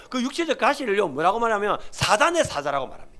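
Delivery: ordinary delivery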